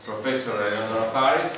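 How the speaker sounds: usual speaking voice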